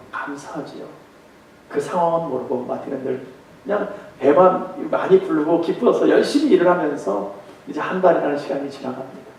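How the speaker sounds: ordinary talk, nothing marked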